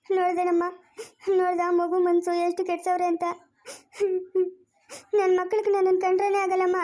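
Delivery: wounded, tearful pleading; crying lament. Acted. Father rejected by his child complains to the woman, crying. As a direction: sob between phrases as you lament. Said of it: "ನೀನ್ ಅಳ್ಬೇಡ ಸುಮ್ನಿರು".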